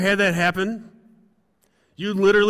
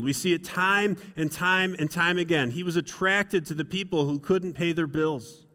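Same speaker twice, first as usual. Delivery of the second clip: ordinary delivery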